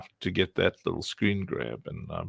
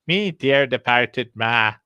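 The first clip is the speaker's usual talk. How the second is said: put-on Irish accent; 3 semitones higher, 6 dB louder, slower than usual. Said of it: "suddenly Irish 'cause we like to say".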